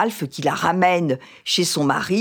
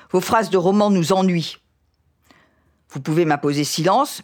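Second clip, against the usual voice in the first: measured, expressive reading of a novel scene; expressive reading of a tense marital confrontation from Indiana; literary reading; 4 semitones higher than usual. Acted. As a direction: read it like a storyteller bringing the scene to life. Reads a novel excerpt aloud.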